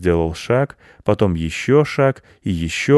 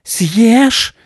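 very high, loud yell; piercing cry from a hare; piercing shriek; 9 dB louder than usual. A narrator's reading. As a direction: shriek it out at full pitch. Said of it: "раздался пронзительный вопль".

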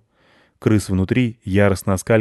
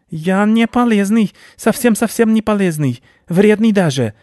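high, loud panicked protest; alarmed rodent squeal; frightened rat; 12 semitones higher, 5 dB louder than usual. A narrator's reading. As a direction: plead desperately as a cornered rat. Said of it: "испугался крыс".

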